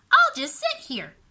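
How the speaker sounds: put-on high childish voice; little girl deciding. A narrator's reading. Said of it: "she decided".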